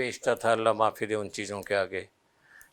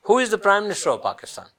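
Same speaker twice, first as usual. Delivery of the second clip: mock condescending ambassador; 9 semitones higher, 4 dB louder, slower than usual. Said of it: "مجھے اتنا سخت غصہ چڑھا".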